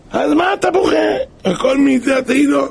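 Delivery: high, crying complaint over his loss; wailing lament. Acted. Robbed goat owner wails to the weeping thief, crying. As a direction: whimper it like a man who lost everything.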